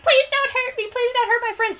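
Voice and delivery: put-on very high, pleading little-girl voice; little elf girl. Little elf girl begging in combat, high pleading. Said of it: "twelve-year-old little, like, elf girl".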